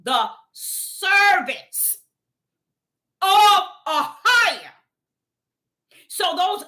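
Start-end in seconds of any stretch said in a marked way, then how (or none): 0.0-4.7 slow, drawn-out preaching; slow pulpit declaration. Acted. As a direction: stretch each word out like a sermon. Preaching, slow emphatic reading.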